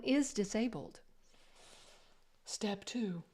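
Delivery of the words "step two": whispering. Pretend. Whispered step cue.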